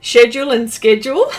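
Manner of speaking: first "schedule" with SH, second "schedule" with SK; SHED-yool, then SKED-yool for "schedule"; both schedule pronunciations. Shown as a demonstration. Host listing words said differently, schedule both ways. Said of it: "we all say things differently".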